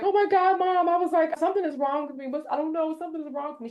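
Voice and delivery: high, teary retold panic; tearful high-pitched plea, reliving it; crying. Daughter on the phone to her mom, crying. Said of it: "I, I'm crying".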